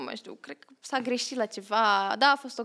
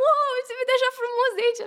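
mock awe; 12 semitones higher, 4 dB louder, faster than usual. Retold awe onstage.

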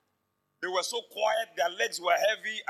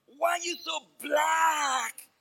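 drawn-out, loud, higher sneer; slow mocking taunt; 7 semitones higher, 3 dB louder, much slower than usual. Classmates mocking the boy in class, slow loud jeer. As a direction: stretch every word to mock him.

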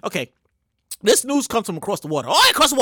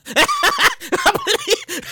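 high laugh. Real, not acted. Laughing at himself.

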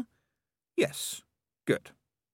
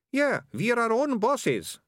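very high, slow sidekick voice; chiming-in sidekick; 14 semitones higher, 3 dB louder, slower than usual. Acted. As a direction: back up your partner in a high thug voice. Sidekick chiming in after his partner.

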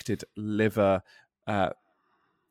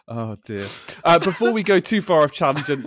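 ordinary delivery

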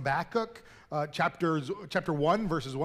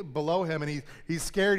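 ordinary talk

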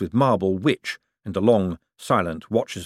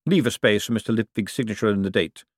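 ordinary delivery

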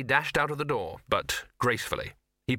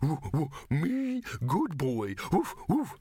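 high yappy mock pup, slow at first; mock eager dog; 4 semitones higher, slower than usual. Grumpy dog mocking the other dog's yapping. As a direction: parody a fawning dog.

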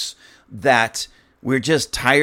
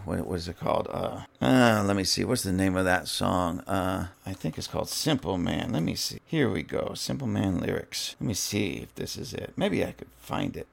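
quiet mutter while typing a song title; muttered to himself; 5 semitones lower, 7 dB quieter than usual. Real, not acted. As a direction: mumble under the breath, searching.

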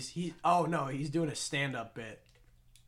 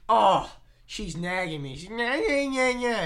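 slow, higher mock-whining complaint; Bill Burr complaining; 7 semitones higher, 8 dB louder than usual. Bill Burr griping about his wife, mock whine. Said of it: "And I'm like".

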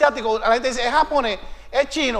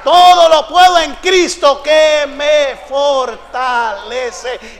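full-force preaching shout, rising to a roar; shouting preacher; 5 semitones higher, 9 dB louder than usual. Real, not acted. Preacher shouting faith to the congregation, full force. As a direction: preach it at the top of the lungs.

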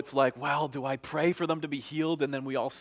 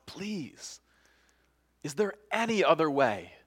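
pleading; 4 semitones higher than usual. Acted. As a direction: beg earnestly for a way out. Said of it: "he asks God".

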